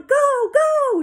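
very high, loud cheer; yelling. A narrator's reading. Friends yelling from the far side, cheering. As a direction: shriek encouragement like excited kids. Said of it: "They were yelling".

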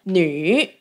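女 in third tone, dipping down then up. Shown as a demonstration. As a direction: say 女 falling then rising. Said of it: "it's a third tone".

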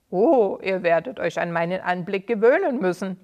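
sneering villain voice, loud; old villain taunting the angry townspeople; malicious grin. Acted. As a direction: gloat with an evil grin. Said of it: "mit einem bösartigen Grinsen".

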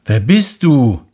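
loud high question shouted; calling out. Acted. Prince shouts into the mountains, loud call. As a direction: call it out searching.

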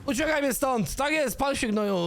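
high, frantic cries mid-fight; screaming while fighting for your life; panicked yelling. Real, not acted. (panika i krzyk w walce z dinozaurem)

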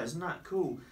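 ordinary delivery

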